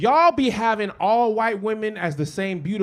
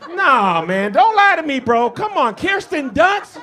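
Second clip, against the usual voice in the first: incredulous; 6 semitones higher, 6 dB louder, slower than usual. Real, not acted. Incredulous challenge to audience.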